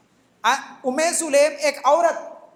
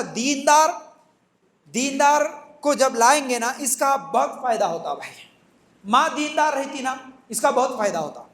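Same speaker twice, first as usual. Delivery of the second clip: ordinary talk, nothing marked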